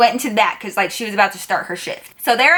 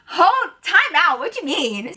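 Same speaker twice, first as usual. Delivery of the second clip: confused; 7 semitones higher than usual. Retold or imitated. Retelling her confused reaction to the boss, baffled. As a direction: halt everything in disbelief.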